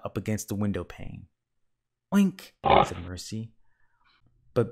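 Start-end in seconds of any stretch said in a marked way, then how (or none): 2.1-2.5 high, loud little oink; piggy squeal of a word. A narrator's reading. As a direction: oink like a pig, pitched up. Pig character oinks in story.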